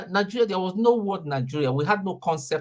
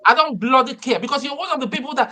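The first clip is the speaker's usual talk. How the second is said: louder and higher, fast and angry; heated outburst; 7 semitones higher, 4 dB louder, faster than usual. Real, not acted. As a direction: snap back furiously at the opponent. Heated argument with a rival speaker.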